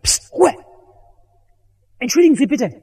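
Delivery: irritated call down. Pretend. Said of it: "hätte der hochgerufen".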